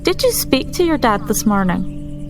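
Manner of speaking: normal talking voice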